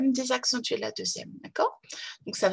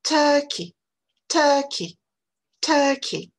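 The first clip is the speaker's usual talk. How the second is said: TURKEY with a strong first syllable, weak second; accent sur la première syllabe; 5 semitones higher, 5 dB louder, much slower than usual. Shown as a demonstration. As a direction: hit the first syllable of turkey high and loud, drop the second. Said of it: "une première syllabe qui est forte". (turkey, première syllabe forte, seconde plus faible)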